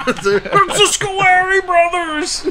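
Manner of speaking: high slow outraged judge; shrill courtroom outrage; Ghostbusters judge impression. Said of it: "the judge from Ghostbusters Two".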